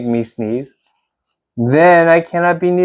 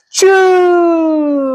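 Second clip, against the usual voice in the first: sneeze; 12 semitones higher than usual. Acted. Fake sneeze ending.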